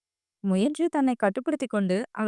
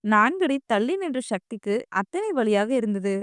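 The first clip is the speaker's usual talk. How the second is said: ordinary delivery, nothing marked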